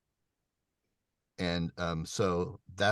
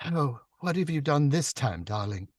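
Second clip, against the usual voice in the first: breathy feminine character voice with an accent; higher, louder lift, a little whisper in it; Zita; 7 semitones higher, 4 dB louder than usual. Shown as a demonstration. Narrator voicing Zita scolding Paul, breathy and accented. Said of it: "There's a little breathiness, a little whisper along with the accent".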